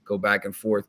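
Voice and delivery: ordinary delivery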